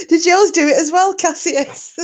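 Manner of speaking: high-pitched, laughing question; real helpless laughter. Real, not acted. Asking Cassius about the Alexa joke, laughing helplessly. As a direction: squeal the question through giggles.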